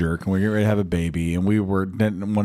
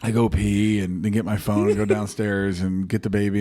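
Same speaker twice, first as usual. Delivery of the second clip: ordinary delivery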